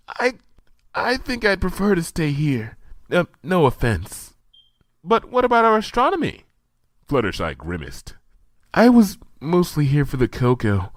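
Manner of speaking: high, hesitant apologetic voice; politely backing out; shy Fluttershy. A narrator's reading. Fluttershy to Twilight, shy and apologetic.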